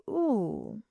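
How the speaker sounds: wincing in hindsight; a soft embarrassed ooh; cringing ooh. Real, not acted. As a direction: cringe at the memory. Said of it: "looking back and I'm just like".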